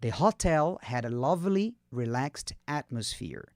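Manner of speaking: slow model reading. Shown as a demonstration. Teacher modelling the hotel sentence for repetition, slowly in chunks. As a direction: take it slowly, pausing between chunks. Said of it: "Pega devagarzinho".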